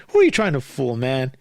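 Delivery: ordinary delivery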